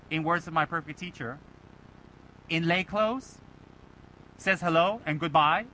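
ordinary talk, nothing marked

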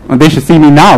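ordinary delivery

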